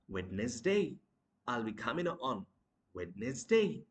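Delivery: Wednesday as "WED-nes-day"; mispronounced Wednesday. Shown as a demonstration. Teacher acting the mistake, Wednesday as "WED-nes-day". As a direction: sound the d in Wednesday, three syllables. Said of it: "We mispronounce the word as".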